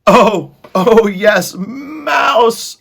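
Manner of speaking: high, loud, excited rat cry; eagerly agreeing rat. A narrator's reading. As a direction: squeal agreement as a greedy rat. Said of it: "agreed another".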